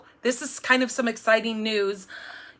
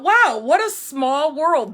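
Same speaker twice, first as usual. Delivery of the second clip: higher, louder, slowed-down wonder; amazed; 5 semitones higher, 7 dB louder, slower than usual. Retold or imitated. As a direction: marvel at the coincidence. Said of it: "I just thought".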